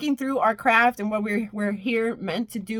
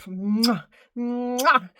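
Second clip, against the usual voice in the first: kiss sounds. Acted.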